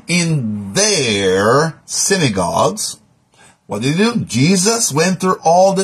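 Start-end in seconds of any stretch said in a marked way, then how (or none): 0.1-3.0 THEIR stretched out long before synagogues; stress on THEIR. Real, not acted. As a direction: linger heavily on THEIR, very slowly. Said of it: "emphasizing the word there".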